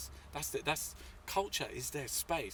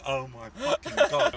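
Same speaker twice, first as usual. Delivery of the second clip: drawn-out shocked gasp of words; shocked reaction while crawling in the street; stunned exclamation; 11 dB louder, slower than usual. Retold or imitated.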